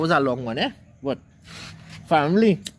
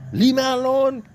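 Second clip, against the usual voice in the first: high, louder exasperated outburst, retold; cussing; 11 semitones higher, 3 dB louder than usual. Man to the meowing cat, retold exasperated cussing. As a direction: escalate the scolding, acted out high and stretched. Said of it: "And I cussing him".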